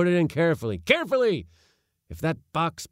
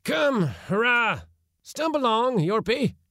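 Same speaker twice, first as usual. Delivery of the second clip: high, loud call to hurry up; impatient cry; 5 dB louder than usual. A narrator's reading. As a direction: holler impatiently at a straggler. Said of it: "cried my uncle, impatiently".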